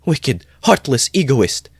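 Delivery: high, loud, slow accusation; indignant cry. A narrator's reading. Sister condemning her brother as he leaves, crying out indignantly. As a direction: cry out each word, outraged. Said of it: "cried Dunya".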